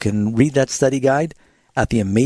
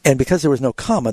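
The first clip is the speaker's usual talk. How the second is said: usual speaking voice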